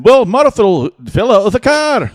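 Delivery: loud, high Scottish radio DJ; mock Scottish accent. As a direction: shout the joke in broad Scottish. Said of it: "street jokes with Scottish accents".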